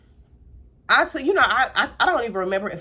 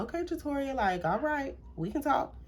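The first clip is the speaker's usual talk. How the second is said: softer, serious voice of a mom; 7 semitones higher, 9 dB quieter than usual. Retold or imitated. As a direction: mom speaking softly and seriously to her daughter. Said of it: "when it gets serious".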